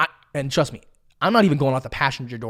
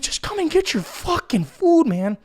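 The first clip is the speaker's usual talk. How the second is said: raised-pitch, drawn-out exasperated plea; stressed exasperation; 11 semitones higher, slower than usual. Retold or imitated. Stressed driver wants farmer to take his food, exasperated. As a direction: plead slowly, at the end of patience. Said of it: "I'm so sad and stressed".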